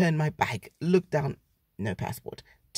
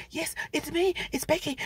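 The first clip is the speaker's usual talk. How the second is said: much higher, eager yes yes; excited; 12 semitones higher than usual. Retold or imitated. Claiming the passport from the officer, excited and high. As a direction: jump at it, relieved and thrilled. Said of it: "so excited".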